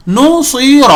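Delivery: نصیر with heavy sad; model word naseer. Shown as a demonstration. Teacher models the heavy sad. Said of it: "یہاں بھی دیکھیے صاد".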